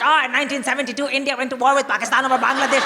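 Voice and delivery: fast, blurted fact-dumping; hurried, overeager arguing; rapid-fire rant. Retold or imitated. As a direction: throw random facts out in a rush. Rattles off random facts mid-rant.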